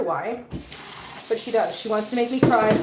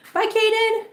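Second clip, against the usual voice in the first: high bright goodbye to the pet, higher pitch; calling out; 11 semitones higher than usual. Real, not acted. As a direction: call it out high to the dog.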